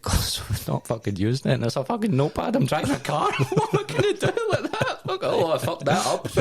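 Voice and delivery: high, laughing indignation; laughing retort. Retold or imitated. Retelling the row with the instructor, laughing hard. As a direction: laugh through the outrage.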